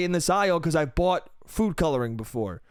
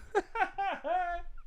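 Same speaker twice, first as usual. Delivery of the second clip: laugh; 11 semitones higher, 9 dB quieter than usual. Real, not acted. Laughs after acted outburst.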